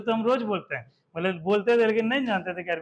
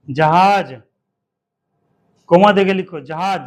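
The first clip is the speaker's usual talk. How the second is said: dictation call; 2 semitones lower, 11 dB louder, slower than usual. Shown as a demonstration. Teacher dictating जहाज to pupils, loud. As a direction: call जहाज out slowly for writing.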